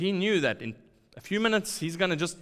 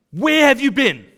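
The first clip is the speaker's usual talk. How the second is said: loud, high demanding question; scolding parent; 11 semitones higher, 12 dB louder than usual. Retold or imitated. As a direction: confront a child home late. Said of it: "as a parent might question their child".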